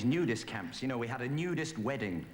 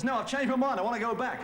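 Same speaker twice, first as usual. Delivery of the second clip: much higher and louder, shrill-free mock whine; mother-in-law voice; 6 dB louder than usual. Comedian voicing his mother-in-law mid-swim, mock high complaint.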